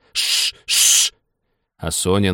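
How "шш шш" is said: sharp unvoiced shushing; two long hisses to silence her; hushing hiss. A narrator's reading. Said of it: "зашипели на неё".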